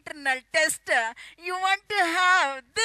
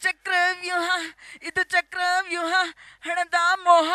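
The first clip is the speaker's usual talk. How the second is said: ordinary talk, nothing marked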